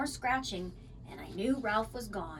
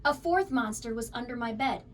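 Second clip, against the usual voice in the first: ordinary delivery, nothing marked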